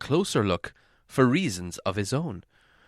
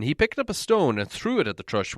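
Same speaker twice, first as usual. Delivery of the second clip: ordinary delivery, nothing marked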